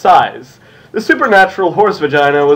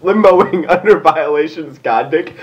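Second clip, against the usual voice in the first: usual speaking voice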